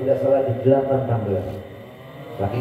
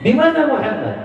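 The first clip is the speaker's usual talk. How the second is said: high pressing question; high, insistent asking; urgent traveller; 11 semitones higher, 4 dB louder than usual. Acted. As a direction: demand where he is.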